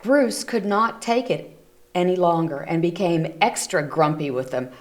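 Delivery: plain reading voice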